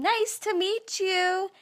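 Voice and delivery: high, loud and slow, falsely pleasant; mock-friendly nurse. Nurse telling the patient to clamp the gauze, mock friendly. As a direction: make the order sound like a cheerful greeting. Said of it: "they say it in a tone that's almost like saying".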